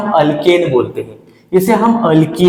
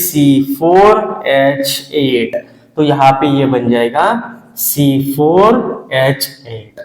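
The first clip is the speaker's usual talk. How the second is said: very slow dictation; 3 semitones lower, much slower than usual. Shown as a demonstration. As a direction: dictate the formula very slowly, each part apart. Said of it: "dictating formula to class". (बहुत धीरे formula बोलते हुए)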